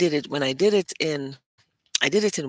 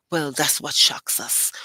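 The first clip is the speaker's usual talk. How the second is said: usual speaking voice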